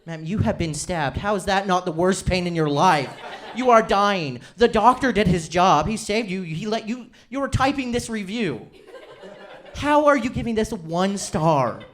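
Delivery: incredulous rant. Real, not acted. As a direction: rant at the absurd reviewer, cracking up. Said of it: "incredulous rant at reviewer".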